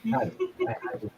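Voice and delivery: very fast, soft and high; flustered aside. Acted. Naked character muttering about his lost robe. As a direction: rush it out in embarrassment.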